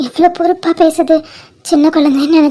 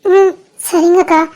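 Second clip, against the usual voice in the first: higher, slow, teary okay; tearful agreement; 4 semitones higher, slower than usual. Acted. Told to stop crying, she agrees in a higher, teary voice. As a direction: nod it through tears, slowly. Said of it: "அழாத".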